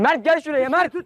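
high urgent yell to friend; shouting for help. Real, not acted. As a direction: call out to Mert loudly. Calling friend during scuffle.

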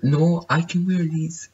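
high, put-on defensive repairman. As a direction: answer as the silly repairman. Repairman defending his slippers.

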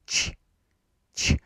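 "ch" softened with the tongue up on the palate; very soft ch. Shown as a demonstration. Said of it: "This is very soft".

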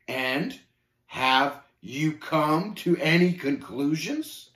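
slow, pointed challenge; tense question, drawn out word by word; terse. Acted. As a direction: space the words out, bristling. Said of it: "asked tersely".

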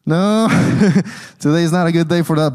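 laughing no, then a fast excuse; laughing refusal. Retold or imitated. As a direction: brush him off with a laugh, quick. Joking refusal to census taker.

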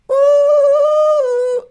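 high, loud spooky ooh; ghost voice. Pretend. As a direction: wail like a cartoon ghost. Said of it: "that sounded like a ghost too".

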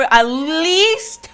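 AT LEAST drawn out long and loud; emphatic plea. Real, not acted. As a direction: stretch out "at least" with rising pitch. Pleading for a minute of niceness.

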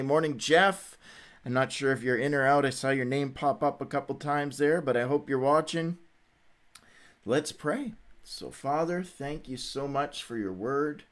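ordinary delivery

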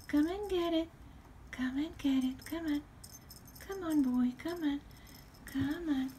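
high, sing-song pet coaxing; calling a dog. Real, not acted. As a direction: coax the cat over sweetly. Coaxing the cat to come.

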